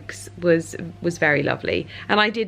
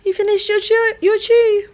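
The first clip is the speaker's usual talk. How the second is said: high cooing voice to the dog; 5 dB louder than usual. Real, not acted. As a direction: coo at the dog about its chew. Baby talk to dog.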